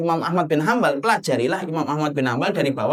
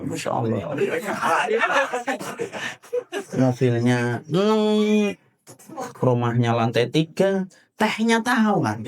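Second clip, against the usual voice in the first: amused disbelieving guest; slower than usual. Retold or imitated. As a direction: mutter your disbelief at the plain tea. Javanese guest's inner reaction.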